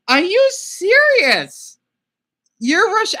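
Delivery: can't believe the stranger is Russian; excited disbelief. Real, not acted. To a video-chat stranger who turns out Russian, excited shouting.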